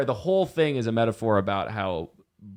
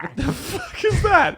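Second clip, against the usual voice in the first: shocked; slower than usual. Real, not acted. Reacts to goblin voice.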